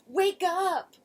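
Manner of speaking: loud high frightened call; crying out. A narrator's reading. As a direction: shout his name in fear. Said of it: "he cried".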